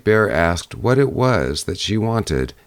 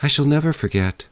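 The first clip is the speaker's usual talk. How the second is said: put-on higher, earnest young girl; eager girl's promise; 8 semitones higher, faster than usual. A narrator's reading. Girl promising the bear, high and earnest.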